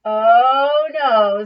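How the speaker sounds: loud shocked groan; loud dismay. Acted. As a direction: react in dismay. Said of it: "said the grunts".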